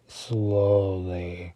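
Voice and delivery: "slowly" stretched very long and low-key; drawn-out menace. Acted. Villain gets to break her, "slowly" drawn out menacingly. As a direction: draw out "slowly" as a villain's threat.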